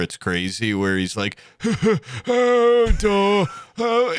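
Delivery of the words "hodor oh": high, loud, drawn-out moaning call; Hodor. Retold or imitated. Fan imitating the audiobook narrator, over-the-top drawn-out cry. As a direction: go all out on a hammed-up character voice. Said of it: "he goes so hard for Hodor".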